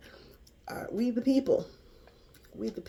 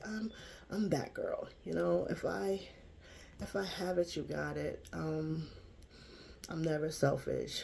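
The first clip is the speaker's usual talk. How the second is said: normal talking voice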